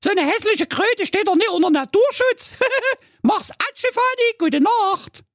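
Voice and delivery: very high squeaky scoffing; scornful squeaky girl; dismissive princess calling out. Acted. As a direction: brush daddy off in a piping voice. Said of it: "Carola Knackwurst rief erneut".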